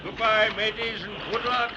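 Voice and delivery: high, carrying goodbye; calling farewell. Acted. Captain to departing friends in rowboat, calling goodbye. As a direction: call it out to a departing boat.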